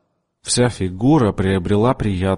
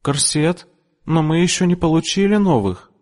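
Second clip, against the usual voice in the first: a touch of high nervous voice; timid shopgirl; 9 semitones higher than usual. A narrator's reading. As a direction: answer the customer meekly. Frightened shopgirl answers. (робкая продавщица отвечает капризной клиентке)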